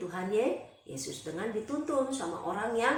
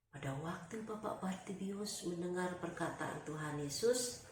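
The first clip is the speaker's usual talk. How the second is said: ordinary delivery, nothing marked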